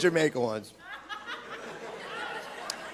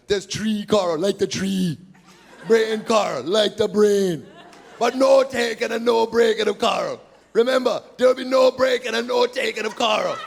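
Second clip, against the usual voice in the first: Jamaican tour guide; 4 semitones higher than usual. Retold or imitated. Comic imitating the glass bottom boat guide in a Jamaican accent. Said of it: "He goes".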